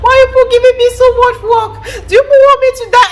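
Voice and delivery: high, loud mock wail; exasperated mommy. Mum wailing at her messy kids, high and loud. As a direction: wail it like a put-upon mum. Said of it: "I tell them".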